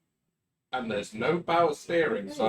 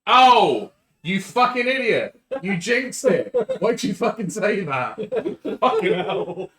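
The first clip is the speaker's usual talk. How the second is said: loud, high-pitched cursing at a teammate; angry outburst; 9 semitones higher, 8 dB louder than usual. Real, not acted. Player blames teammate for jinxing the dice roll, angry outburst. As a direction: yell it in exasperation, pitch shooting up.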